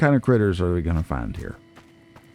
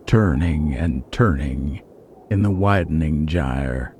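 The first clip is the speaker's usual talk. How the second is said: poem recitation; 2 semitones lower than usual. Acted. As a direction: recite it like a grave prophecy. Recites Yeats poem after title.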